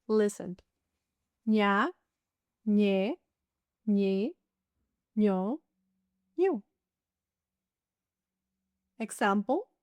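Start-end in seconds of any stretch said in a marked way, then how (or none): 1.5-6.6 ÑA, ÑE, ÑI, ÑO, ÑU with a "ny" sound, spaced apart; Ñ syllable drill. Shown as a demonstration. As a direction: recite "Ña, ñe, ñi, ño" rising, "ñu" falling. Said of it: "This is a special Spanish letter".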